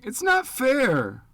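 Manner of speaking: put-on loud, high, slow child's scream with NOT stretched; a grieving boy screams at the adults around him. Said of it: "and screaming".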